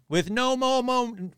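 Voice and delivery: slow, high, stretched on one held note; mock slogan. Podcaster fumbling for an episode title, mock proclamation.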